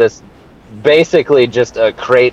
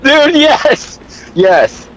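excited; much slower than usual. Real, not acted. Excited about the fake sponsor.

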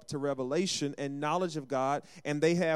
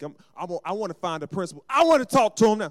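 prideful parent demand; 3 semitones higher, 9 dB louder than usual. Retold or imitated. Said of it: "you gotta keep going in there like".

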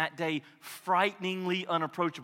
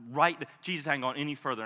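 ordinary talk, nothing marked

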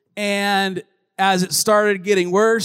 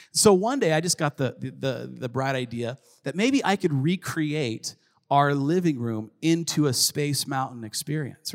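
usual speaking voice

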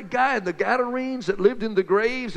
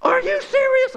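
much higher and louder disbelief; astonished; 5 dB louder than usual. Acted. Friend hearing Jesus walked on water, astonished high cry. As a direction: shriek out the disbelief.

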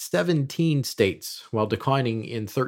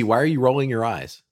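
usual speaking voice

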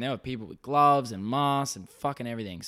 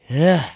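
loud exasperated ugh; loud disgusted groan; 4 semitones higher, 12 dB louder than usual. Real, not acted. Host fed up with voting rules, loud disgusted groan. Ranting about in-person voting.

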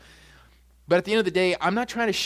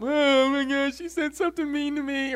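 much higher, mocking a touchy churchgoer; mock whiny complainer; 9 semitones higher than usual. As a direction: whine it like someone easily offended.

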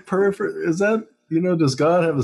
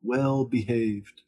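mock submissive pleading; 4 dB quieter than usual. Begging for rules as a good slave, meekly pleading. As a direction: plead meekly, trailing into a very soft promise. Voicing a fearful, submissive people-pleaser.

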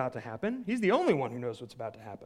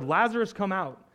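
raised-voice summons, louder and higher; shouted command; 4 semitones higher, 7 dB louder than usual. A narrator's reading. Preacher reading Jesus calling Lazarus from the tomb, shouting. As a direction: call out firmly to someone inside. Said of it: "Jesus shouted".

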